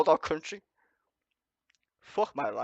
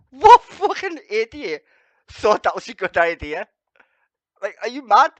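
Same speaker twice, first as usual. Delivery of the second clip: furious outburst at a stupid idea; furious shout; 4 semitones higher, 12 dB louder than usual. Real, not acted. Ranting at the clip.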